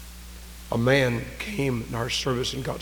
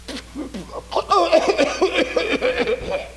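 high, strained mock hacking and gasping; imitated coughing fit; 13 semitones higher than usual. Preacher imitating lung cancer patients in the hospital, mock coughing fit. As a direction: act out a long, choking cough. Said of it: "I've seen people in the hospital just".

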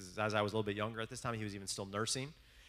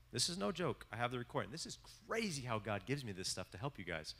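ordinary talk

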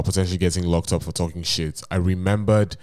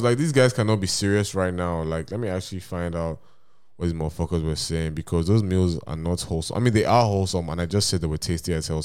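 ordinary talk

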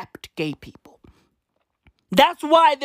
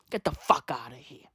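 whispered dismissal; 10 semitones lower, 8 dB quieter than usual. Real, not acted. Dismissive aside to critics.

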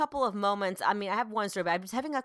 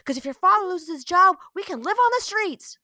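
high, loud mock-furious scolding; losing her mind; 11 semitones higher, 9 dB louder than usual. Mom retells ranting at her son about dad's job, unhinged. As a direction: re-enact a mother's over-the-top rant. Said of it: "I just lost my mind".

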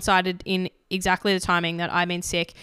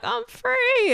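very high, squeaky I'm free; dog's voice. Retold or imitated. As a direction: voice the happy dog. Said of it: "And he was like".

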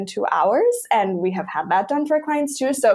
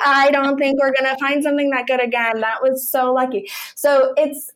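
ordinary delivery

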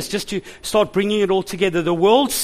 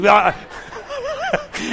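high burst of laughter. Real, not acted. Laughing at own story.

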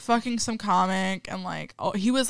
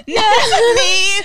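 shrieking laugh; 12 semitones higher, 7 dB louder than usual. Real, not acted.

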